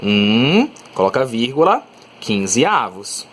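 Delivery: slow dictation: um, coloca vírgula, quinze avos; slow spelled-out reading. Shown as a demonstration. Teacher dictating the fraction slowly to students. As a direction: read each part slowly for the class. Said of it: "Eu vou falar assim, ó".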